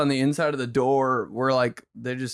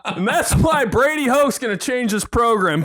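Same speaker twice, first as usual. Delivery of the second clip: higher know-it-all voice; mock smug lecture; 8 semitones higher than usual. Friend parodying dad's lecture with a football joke.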